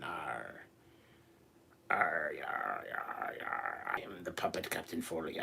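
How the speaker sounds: mock pirate growl as a puppet captain; pirate accent. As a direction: arr it like a hammed-up pirate puppet. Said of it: "I don't know why I have a goddamn accent".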